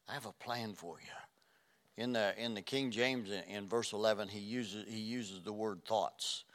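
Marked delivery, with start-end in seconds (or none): 0.1-2.0 slow and soft, lingering on have; tender slow repeat of God's words; slow hushed. Acted. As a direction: let the promise sink in gently.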